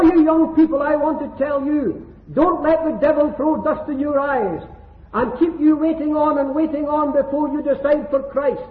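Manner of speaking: ordinary delivery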